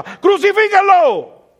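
high, loud, drawn-out yell; crowd shout. Acted. Preacher acting himself in the crowd condemning Jesus, shouting. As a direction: scream it like one of the mob. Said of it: "el primero que gritaba".

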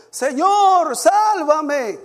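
high, loud plea for rescue; crying out. A narrator's reading. Preacher reading Pedro's cry as he sinks, shouted plea. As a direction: cry out to be saved, drawing out the words. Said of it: "dio voces diciendo".

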